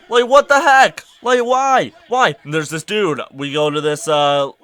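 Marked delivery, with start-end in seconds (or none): 0.1-2.4 high, loud outburst of disbelief; loud exasperation. Real, not acted. Protesting the tickler's hypocrisy, loudly exasperated. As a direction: burst out in protest, pitch jumping up.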